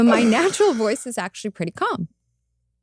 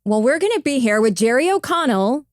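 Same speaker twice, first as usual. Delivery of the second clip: bright, punchy TV voiceover read; slightly louder anchor read; tracking voice; 4 semitones higher, 4 dB louder than usual. Shown as a demonstration. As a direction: sell it like a news voiceover. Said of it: "then I'll do my tracking voice".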